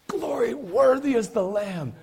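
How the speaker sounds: slow, high cry of praise; exultant worshipper. Acted. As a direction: draw it out high like heavenly worship. Acts out worship in heaven.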